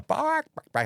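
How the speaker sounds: high imitated hen squawk; chicken squawk. Chicken Man pecking at the ladies, squawking. Chicken act moving through crowd.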